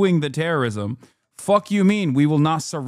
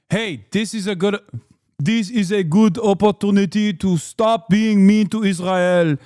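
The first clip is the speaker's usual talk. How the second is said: sarcastic, louder put-on official voice; mocking impression; 3 semitones higher, 3 dB louder than usual. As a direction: parody the smug official. Streamer mocks Israeli officials' line.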